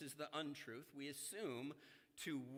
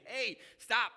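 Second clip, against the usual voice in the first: mock call-out; 7 semitones higher, 10 dB louder than usual. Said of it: "I had to bite my tongue in class saying".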